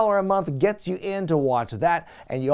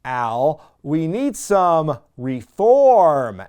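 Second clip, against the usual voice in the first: very slow, louder, with heavy pauses; slow pointed hint; 6 dB louder, much slower than usual. Retold or imitated. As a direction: spell out the hint word by word. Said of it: "MVP again is like".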